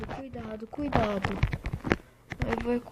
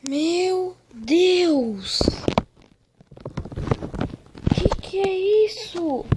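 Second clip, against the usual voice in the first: shocked; 5 semitones higher, 7 dB louder, much slower than usual. Real, not acted. Startled by chest contents.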